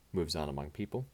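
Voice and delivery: normal talking voice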